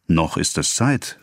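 usual speaking voice

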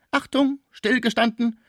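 high-pitched mock drill order; military command. A narrator's reading. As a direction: call them to attention, pitched high. (militärisches Kommando, hoch gerufen)

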